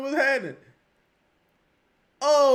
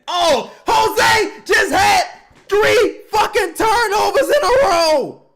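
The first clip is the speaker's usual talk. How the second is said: furious drawn-out shout; 11 semitones higher, 10 dB louder, much slower than usual. Real, not acted. Raging at three turnovers.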